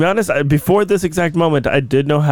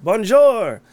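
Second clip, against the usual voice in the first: French greeting; 7 semitones higher than usual. Acted.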